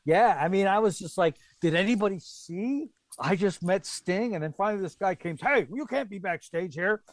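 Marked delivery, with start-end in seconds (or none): 1.6-4.3 high, amazed outburst; retold giddy astonishment; starstruck disbelief. Retells his starstruck reaction. 5.4-7.0 high-pitched retold telling-off; imitating a backstage guard; officious stagehand. Voices guard throwing him out.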